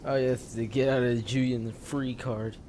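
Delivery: ordinary talk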